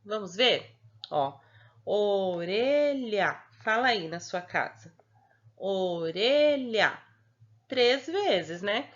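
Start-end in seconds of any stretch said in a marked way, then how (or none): 1.8-3.3 ORELHA stretched very slowly into three long beats; syllable-by-syllable. Shown as a demonstration. Teacher shows children how the mouth opens, "orelha" in slow syllables. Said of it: "quantas vezes você abre a boquinha". 5.6-7.0 very slow, sustained "orelha" for counting beats; syllable-by-syllable. Shown as a demonstration. Teacher has pupils say it at home, "orelha" in slow syllables. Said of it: "quantas vezes você abre a boquinha".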